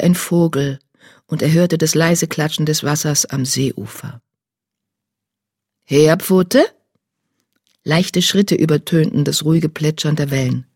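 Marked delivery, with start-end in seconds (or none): 5.8-6.6 name called as a question, rising sharply at the end; mentor's questioning call. A narrator's reading. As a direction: call out searchingly, voice lifting on the last syllable. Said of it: "hörte seine Mentorin Blattsee".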